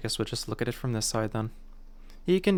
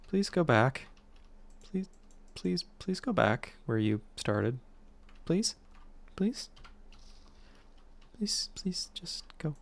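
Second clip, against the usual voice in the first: pleading that dwindles to soft, high begging; 4 semitones higher, 5 dB quieter, slower than usual. Real, not acted. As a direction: beg it, getting smaller with each please. Begs the game to undo.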